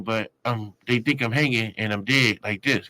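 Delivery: ordinary talk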